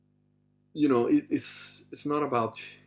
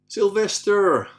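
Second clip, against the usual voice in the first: loud, high, drawn-out name call; announcing a name; 9 semitones higher, 8 dB louder, much slower than usual. Real, not acted. Host announces the next questioner, drawn-out call. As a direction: call the name out slowly and big.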